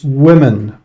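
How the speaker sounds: "women" said emphatically as WIM-in; correct pronunciation. Shown as a demonstration. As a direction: lean on the short i in "women": WIM-in. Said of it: "imagine it was written with an I".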